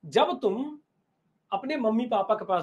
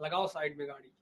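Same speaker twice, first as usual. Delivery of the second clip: much lower and softer, a secret aside; hushed signal; 9 semitones lower, 12 dB quieter than usual. Acted. Baba quietly signals disciples to stop the car, hushed. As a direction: murmur the order under your breath. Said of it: "बाबा ने अपने चेलों को इशारा किया".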